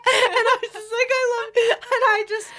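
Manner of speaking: high, loud voice cracking up; tearful laughing. Real, not acted. Telling co-host about loving the partner, tearful laughing. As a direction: gush helplessly through laughter and near-tears. Overcome retelling love, laughing.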